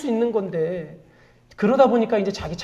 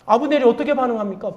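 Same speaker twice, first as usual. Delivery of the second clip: ordinary talk, nothing marked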